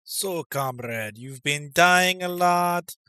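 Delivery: slow, booming mock Russian drill-sergeant voice; mock Russian accent. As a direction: play a gruff Russian comrade giving advice. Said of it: "I don't know why I'm doing this accent".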